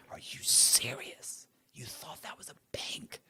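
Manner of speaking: slow whispered disbelief; incredulous whisper. Retold or imitated. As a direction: whisper it, amazed they fell for it. Mocking agents in his head.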